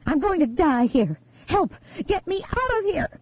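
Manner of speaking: high, frantic pleading; terrified scream. A narrator's reading. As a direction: beg to be pulled out of danger. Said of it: "The voice wasn't Jane's".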